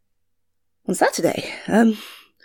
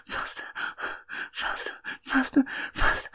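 urgent; 6 semitones higher, 4 dB quieter than usual. Acted. Urgent pleading.

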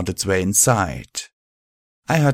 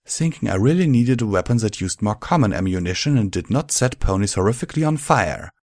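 usual speaking voice

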